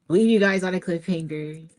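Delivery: ordinary talk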